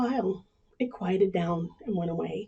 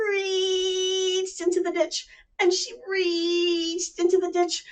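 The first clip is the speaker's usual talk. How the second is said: slow stretched narration; 8 semitones higher, slower than usual. A narrator's reading. Storyteller stretches the reaching.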